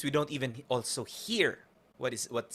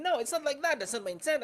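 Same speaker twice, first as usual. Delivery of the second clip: a higher, protesting "No, it's not like that"; lightly voiced defensive partner in an argument; defensive other party; 11 semitones higher than usual. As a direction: play the other side explaining themselves in a raised voice. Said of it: "the other party tends to explain themselves".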